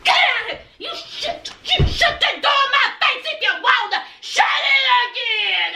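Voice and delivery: high, hammed-up screaming rant; acted furious yelling. Woman shut out by her man, acted furious yelling. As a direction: explode at him so the neighbours hear, play-acted. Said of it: "they would have heard me".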